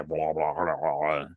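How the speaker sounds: imitated garbled trooper radio chatter; low, warbling mock-electronic gibberish; warbly voice modulator. Death troopers talking to each other through helmets, warbly modulated voice. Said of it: "that weird warbly voice modulators".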